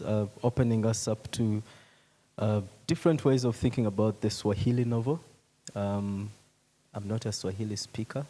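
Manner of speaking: usual speaking voice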